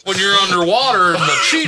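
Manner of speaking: loud laughing joke; laughing shout. Pretend.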